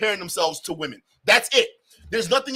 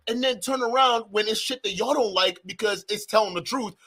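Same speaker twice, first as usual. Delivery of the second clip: normal talking voice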